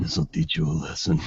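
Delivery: low, soft creepy threat; sinister low undertone; said softly. Acted. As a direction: murmur it deep and hushed. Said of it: "heard Hayden say softly".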